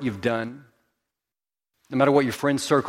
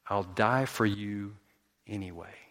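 hushed, unhurried promise; tender resolve; 4 semitones lower, 8 dB quieter than usual. Acted. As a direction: let the last word drop almost to nothing. Jesus answers those who scorn him.

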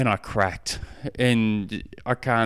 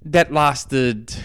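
usual speaking voice

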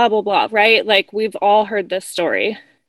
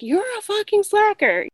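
retold swearing; 10 semitones higher than usual. Client swearing as she storms out, lighter imitated anger. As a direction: mimic her parting insult at a high pitch. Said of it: "she kept swearing".

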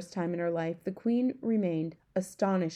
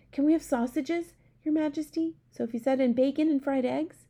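put-on higher, eager girl's asking; excited child asking for a treat; eager request; 7 semitones higher than usual. A narrator's reading. Sophie asks eagerly.

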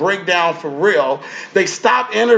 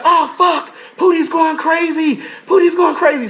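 very high, alarmed cry; mock alarmed onlookers; 10 semitones higher than usual. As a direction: pitch way up like panicked castmates. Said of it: "So it's like".

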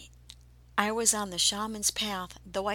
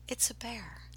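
whispered realization; 8 dB quieter than usual. Retold or imitated. Realizing it's a bear.

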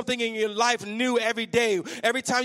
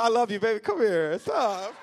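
ordinary delivery

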